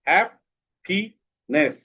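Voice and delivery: "Happiness" in three slow, separate beats; split into syllables. Shown as a demonstration. Teacher showing pupils the syllable count, "happiness" split slowly. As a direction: break "happiness" apart with clear pauses between syllables. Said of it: "এটা কয়টা syllable আছে".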